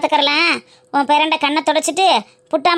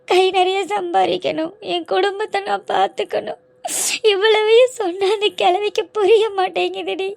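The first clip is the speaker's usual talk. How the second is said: ordinary talk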